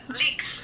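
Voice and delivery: "leaks" with a long EE, meaning legs. Retold or imitated. Imitating Danny's word for legs, leaks with long EE. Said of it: "because she says leaks".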